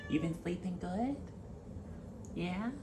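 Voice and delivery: high little voice for a pet; pet talk. Real, not acted. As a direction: coo high questions at a beloved pet. Talking to the pet.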